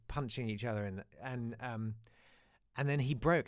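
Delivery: ordinary talk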